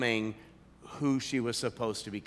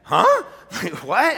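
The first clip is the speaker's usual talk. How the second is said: high, loud astonished huh; stunned surprise; 7 semitones higher, 10 dB louder than usual. Retold or imitated. Pastor reacting to Sandy's baptism news, astonished. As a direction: blurt out total surprise. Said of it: "And I said".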